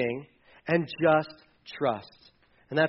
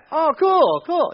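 awkward excited reply; 12 semitones higher, 8 dB louder, slower than usual. Acted. Awkward reply to Big Macs.

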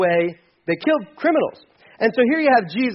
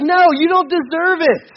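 much higher, louder outraged no; indignant refusal; 10 semitones higher, 5 dB louder, slower than usual. Retold or imitated. Preacher playing an unforgiving Jesus, indignant. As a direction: fling the refusal out, scandalized. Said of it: "So I would be like".

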